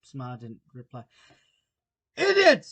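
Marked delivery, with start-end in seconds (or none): 2.1-2.6 loud, high impatient outburst. Acted. Queen rebuking the knave who only bowed, impatient shout. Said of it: "tossing her head impatiently".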